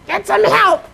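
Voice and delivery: very high, slow scolding wife voice; nagging wife. Retold or imitated. Wife scolding husband over crown molding, high nagging. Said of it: "your wife says".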